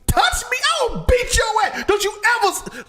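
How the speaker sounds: high, loud mock yelling; enraged father. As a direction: blow up as a furious dad, played for comedy. Acting father's furious reaction.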